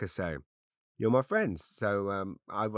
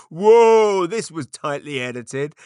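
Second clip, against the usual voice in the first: mock amazed; 8 semitones higher, 13 dB louder, slower than usual. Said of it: "anyone's got this far thinking".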